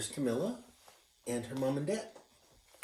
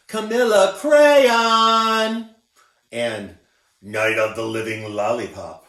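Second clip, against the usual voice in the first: mocking taunt; 12 dB louder, slower than usual. A narrator's reading. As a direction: taunt her like a schoolyard bully. Kids taunting Camilla.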